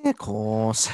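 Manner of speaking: high, loud Scouse Carragher voice; hammed-up Scouse question, pitched well up; Scouse accent. Carragher impression questioning Gerrard in a loud Scouse voice. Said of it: "pretty good Scouse accent".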